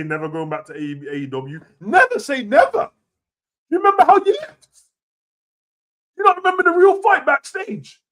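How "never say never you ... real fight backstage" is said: higher, louder challenge; incredulous heated questions; heated rant. Real, not acted. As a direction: confront him, outraged. Ranting at Punk.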